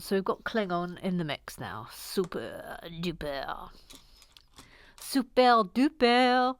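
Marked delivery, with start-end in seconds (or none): 1.9-3.7 low, soft, slow super duper; drawn-out catchphrase. Pretend. Host savouring a catchphrase, slow and low. 5.0-6.6 slow, stretched, put-on super duper; put-on catchphrase voice. Host repeating a catchphrase, drawn-out put-on voice.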